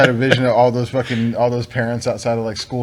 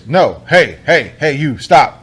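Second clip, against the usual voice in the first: scaring off a bear; 4 dB louder, much slower than usual. Shown as a demonstration. Shouting to scare a bear.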